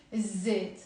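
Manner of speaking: الزيت as iz-zet, no L; silent lam. Shown as a demonstration. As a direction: hold a double Z at the start of الزيت. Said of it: "we don't pronounce the lam".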